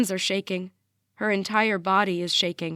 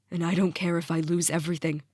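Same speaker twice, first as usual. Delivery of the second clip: voice breaking; 3 semitones lower, faster than usual. A narrator's reading. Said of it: "His voice breaks with each word".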